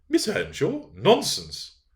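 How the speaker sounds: cried in disbelief. A narrator's reading. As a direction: scoff in shocked surprise. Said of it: "cried the dame".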